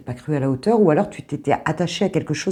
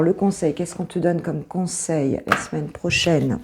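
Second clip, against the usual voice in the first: normal talking voice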